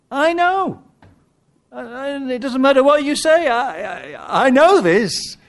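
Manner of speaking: high-pitched, emphatic insistence; stubborn certainty, pitched high; insistent believer. Acted. Acts believer insisting to doubters.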